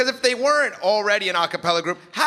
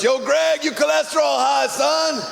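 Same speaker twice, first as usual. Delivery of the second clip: hollered health alert; 7 semitones higher, slower than usual. Retold or imitated. Kid screams a health alert at Greg across the tracks: high yell. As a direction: shout it like a subway caller. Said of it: "hire a Puerto Rican kid to scream across the subway tracks".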